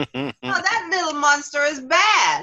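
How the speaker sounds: judge the bad child in a high, stretched voice; mock disapproval. Voicing onlookers judging someone's unruly child.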